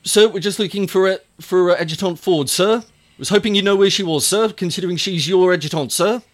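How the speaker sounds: high, brisk soldier reporting to an officer; quick and high, stressing each sir; eager soldier. Acted. GM voices sergeant Tarsh.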